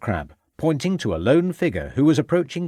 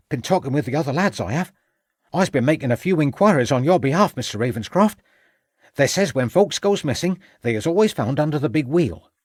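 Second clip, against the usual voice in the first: put-on higher-pitched boyish informant; self-important servant lad; 5 semitones higher than usual. Boy reports his inquiries to Ravenscroft, eager and higher-pitched.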